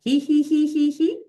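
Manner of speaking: high giggling he-he-he; high hee laugh. Shown as a demonstration. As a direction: giggle it up in the throat. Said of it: "So just try that".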